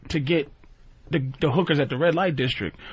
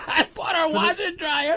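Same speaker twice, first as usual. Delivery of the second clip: laughing, higher pitch; real laughter, higher; 11 semitones higher than usual. Real, not acted. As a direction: say it through laughter. Laughing repeat.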